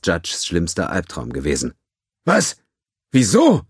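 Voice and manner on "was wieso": higher, louder shocked outburst. A narrator's reading. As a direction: blurt it in alarm. Judge shocked by bad news.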